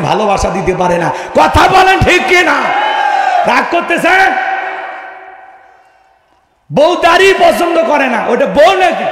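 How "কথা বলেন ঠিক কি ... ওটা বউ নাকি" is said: high, loud preaching cry; forceful challenge. Real, not acted. Preacher challenging men over wives who dislike beards, forceful cry. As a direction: fire it out at the crowd.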